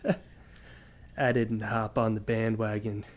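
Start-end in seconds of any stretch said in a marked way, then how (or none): 1.2-3.0 low, smooth sultry voice. Pretend. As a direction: ad voice, pitched down and sultry. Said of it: "sultry voice".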